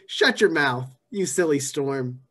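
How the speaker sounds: ordinary delivery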